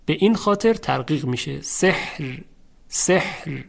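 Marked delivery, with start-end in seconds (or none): none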